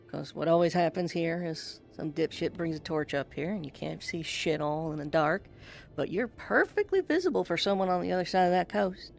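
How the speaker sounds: normal talking voice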